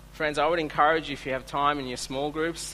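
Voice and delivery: ordinary talk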